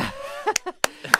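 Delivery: high burst of laughter; nervous laugh. Real, not acted.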